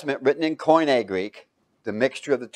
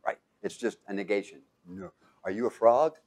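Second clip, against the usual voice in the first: ordinary delivery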